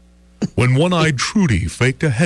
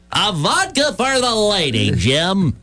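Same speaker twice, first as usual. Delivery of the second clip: lusty yell; 5 semitones higher than usual. A narrator's reading. Casey orders for the lady, a hammed-up high yell. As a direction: call the order like the big shot of the bar. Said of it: "from Casey's throat there rose a lusty yell".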